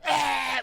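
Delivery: high-pitched mock scream; imitated scream. Father imitating his stung son at the doctor's, high scream. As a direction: re-enact a child's scream in pain. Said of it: "And he's like".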